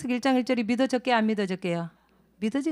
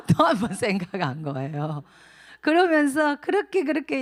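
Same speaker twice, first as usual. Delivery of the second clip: laughing through words; 5 semitones higher, 6 dB louder than usual. Real, not acted. Laughing after the joke.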